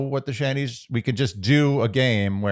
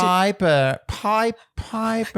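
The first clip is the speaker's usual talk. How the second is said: high-pitched Southern attempt that comes out British; badly faked Southern drawl, pitched up; botched Southern accent; 9 semitones higher, much slower than usual. Co-host mimicking a show's character, botched Southern accent. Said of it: "It sounds British".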